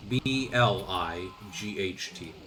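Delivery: letters spelled out slowly one by one; slow spelling out. Shown as a demonstration. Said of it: "how to spell blight".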